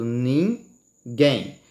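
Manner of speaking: ninguém separado, GUÉM bem marcado; tônica na última. Shown as a demonstration. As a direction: dividir "ninguém" e pesar no GUÉM. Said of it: "Não é bem mais forte?". ("ninguém" devagar, com força no GUÉM final)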